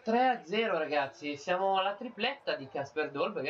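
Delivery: slow, drawn-out commentator call, then shouting; breathless italian goal commentary. Pretend. Goal commentary after third goal.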